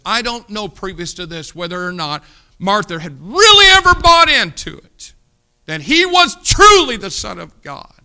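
rising to a full preacher's shout on ever; preaching shout. Real, not acted. Preacher shouting to the congregation about Martha's faith, loud and high. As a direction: shout the doubt up to the rafters.

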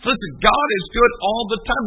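ordinary delivery

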